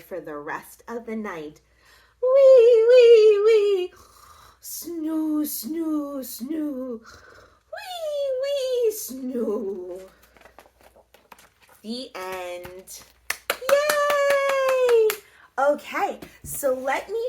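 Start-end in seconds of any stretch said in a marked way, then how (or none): 2.2-10.1 put-on snore like a sleeping baby; snoring sounds. A narrator's reading. Narrator snores for the sleeping baby mummy. 13.5-15.1 much higher excited yay; cheer. Real, not acted. Reader cheers as the story ends.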